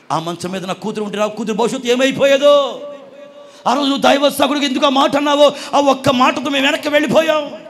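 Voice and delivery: loud, high anguished cry; aunt crying out. Retold or imitated. Mother to the pastor on the phone: loud anguished cry. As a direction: wail out the mother's relief and shock. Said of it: "aunty ఏడుస్తూ".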